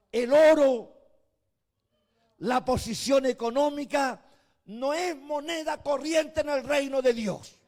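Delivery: high, loud fiery sermon voice; preaching at full force. Acted. Pastor rebuking the rich church, loud fiery preaching. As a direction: thunder it out over the congregation.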